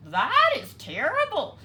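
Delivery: high, loud squawk. A narrator's reading. Ducky Lucky squawking at the news, high and loud. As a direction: squawk it out in shock. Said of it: "squawked Ducky Lucky".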